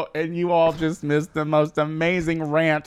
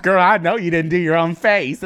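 loud, sassy call-out; catty teasing; 4 semitones higher, 6 dB louder than usual. Retold or imitated. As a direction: throw shade at her. Retelling a catty comment.

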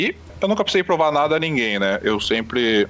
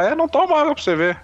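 high-pitched mocking quote; teasing friend; 13 semitones higher, faster than usual. Laughing friend to sick man, high mock taunt. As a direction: imitate a friend's gloating I-told-you-so, pitched way up. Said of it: "e o Vascão rindo".

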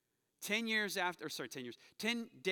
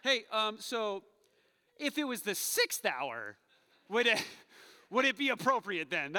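loud, higher, playfully nosy asking; cheeky question; 4 semitones higher, 6 dB louder than usual. Pretend. Pastor pretends to quiz Peter, loud and cheeky. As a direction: poke at it like the class smart aleck. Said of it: "I'm the guy asking the question".

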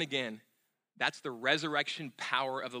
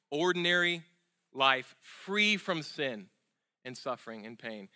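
slow emphatic. Real, not acted.

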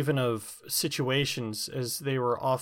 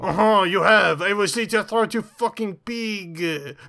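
hammed-up French character threat, higher; jokey menace in a put-on French voice; mock threat; 6 semitones higher, 4 dB louder than usual. The brother character joking a threat at the host in a mock French voice.

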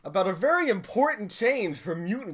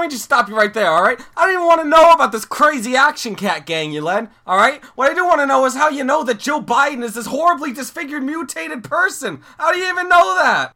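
louder, rising exasperated yelling; exasperated shout; 3 semitones higher, 10 dB louder than usual. Real, not acted. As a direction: yell in disbelief at your co-host.

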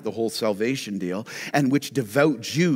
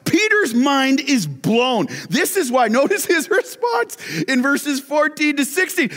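high, loud preacher's cry, stretched out; fired-up preaching; 5 dB louder than usual. Acted. Preacher urging the congregation onto the rooftop, fired up. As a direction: rouse the church to picture the rooftop.

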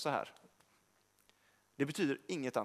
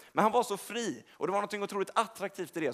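ordinary talk, nothing marked

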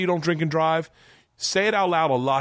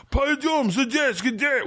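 mock barked Russian; 12 semitones higher, much slower than usual. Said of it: "he's barking out".

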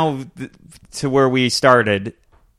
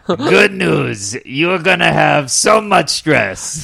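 slow, higher con man; swindler voice; 5 semitones higher, much slower than usual. Retold or imitated. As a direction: drag out the good news. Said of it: "running joke".

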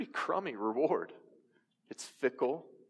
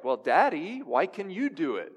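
put-on higher, louder little boy protesting; child's voice; 6 semitones higher, 4 dB louder than usual. Son asks his dad from the back seat, childlike and higher. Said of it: "and Solomon's like".

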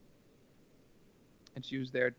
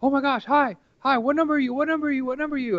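high, loud imitated eager greeting; excited; 13 semitones higher, 8 dB louder, faster than usual. Flashlight lady greeting the runner, imitated high excited voice. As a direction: gush at the runner like a thrilled volunteer. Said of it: "was so excited".

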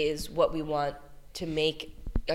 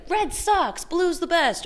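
very high mock schoolkid taunt; jeering kid; 12 semitones higher, 4 dB louder, slower than usual. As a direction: heckle like a kid on the playground. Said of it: "other kids can be like".

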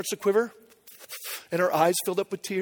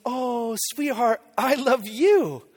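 slow, drawn-out loving reply; retold daddy gush, lingering on the words; doting dad; 3 semitones higher, much slower than usual. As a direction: stretch it out fondly, like a melting father. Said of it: "And I was like".

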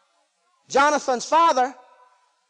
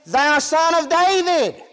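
drawn-out, raised preacher's cry; 3 semitones higher than usual. Acted. Blind men following Jesus, crying out. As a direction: call out slowly, pleading for mercy. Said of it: "crying, saying".

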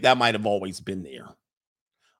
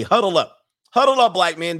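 a louder, higher rallying call; 6 semitones higher, 7 dB louder than usual. Acted. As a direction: summon the brothers like a coach calling a huddle. Calling the men to gather.

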